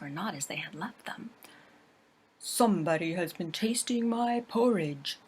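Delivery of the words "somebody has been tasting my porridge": louder gruff growl of a big bear; a big bear's gruff growl. Acted. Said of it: "growled in his great gruff voice".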